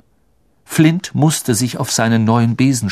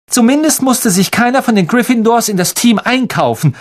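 sharp, higher retort; cutting; 11 semitones higher, 4 dB louder than usual. A narrator's reading. Hermine putting down Malfoy, cutting. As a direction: slice through his bragging. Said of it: "mit schneidender Stimme".

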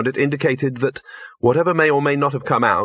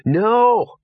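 high-pitched, strained urgent call; urgent hiss; 11 semitones higher, 4 dB louder than usual. A narrator's reading. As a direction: hiss it urgently at a straying dog. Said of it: "hissed Gaspode".

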